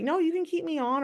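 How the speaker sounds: cheerful polite brush-off; friendly reply. Retold or imitated. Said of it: "Most people are like".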